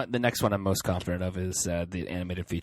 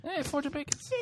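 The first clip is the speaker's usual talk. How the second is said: soft, very high silly voice; put-on falsetto joke voice; joking high voice; 5 dB quieter than usual. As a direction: squeak it playfully, quietly and far above normal pitch. Jokes on misheard movie title.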